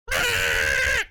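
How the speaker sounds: loud short shout of ah; startled outburst; sudden yelp. Real, not acted. Streamer lets out a sudden yelp at her friend.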